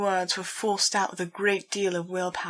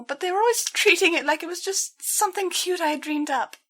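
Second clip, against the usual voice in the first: upset and breathless; 9 semitones higher than usual. A narrator's reading. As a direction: stumble through it on the verge of tears. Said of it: "She paused to catch her breath".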